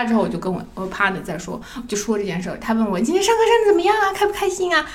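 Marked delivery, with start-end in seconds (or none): 3.0-4.9 high, fast, sing-song coaxing; sweet caring question. Retold or imitated. Partner asks her about class, high and sing-song. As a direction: coax her like a doting partner.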